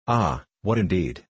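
plain reading voice

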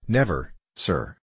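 slow, steady soldier's denial; a sergeant calmly and firmly denying the minister's charge, slow. A narrator's reading. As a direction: deny it calmly, slowly, without heat. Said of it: "with a calm and firm voice".

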